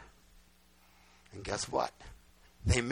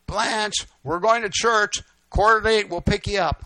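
loud, raised neighborly holler; calling out; 6 semitones higher, 8 dB louder than usual. Retold or imitated. Mom to the widow next door, calling out loudly. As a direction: call it across the yards.

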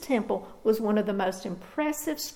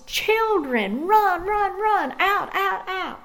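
raised, high-pitched mother's cry of alarm; acted alarmed scream; 8 semitones higher, 4 dB louder, slower than usual. Mother screaming at her children near baby skunks, acted alarmed cry. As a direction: call out urgently, voice jumping high. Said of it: "She screamed at the top of her lungs".

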